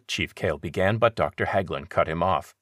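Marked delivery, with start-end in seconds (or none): none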